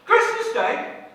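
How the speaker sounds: high, laughing, amazed; delighted disbelief. Acted. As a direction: gasp then cry out in joy.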